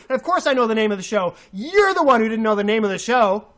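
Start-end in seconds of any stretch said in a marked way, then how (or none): none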